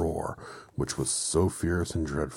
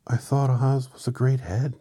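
put-on girl's voice; 9 semitones higher, 9 dB louder than usual. Dorothy voiced.

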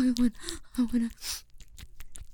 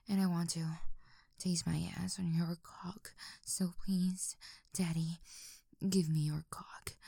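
soft, unvoiced pleading whisper; 5 semitones lower, 9 dB quieter than usual. Begging Daddy in a soft whisper. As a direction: hush it out.

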